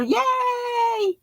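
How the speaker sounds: high, drawn-out squeal; light high-pitched yay; excited cheer. Real, not acted. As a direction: squeal it softly to welcome a friend. Cheers a viewer's arrival.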